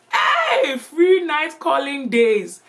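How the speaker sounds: high shouted whoop through a laugh. Real, not acted. Storyteller cheering at the memory of free night calling.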